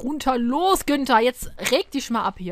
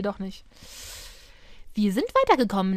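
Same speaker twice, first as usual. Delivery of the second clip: normal talking voice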